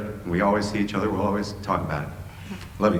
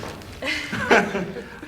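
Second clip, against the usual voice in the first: laugh. Real, not acted. Emotional farewell toast.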